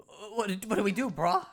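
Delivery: loud, simple-minded cave dweller talk; caveman Cragnon voice. Acted. Scared Cragnon hiding from the Floro Sapiens, blunt caveman voice. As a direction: bark it out like a dim, eager caveman.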